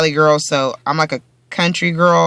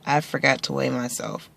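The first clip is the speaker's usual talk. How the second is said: ordinary delivery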